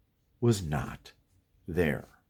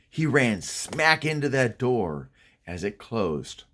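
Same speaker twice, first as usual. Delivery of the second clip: plain reading voice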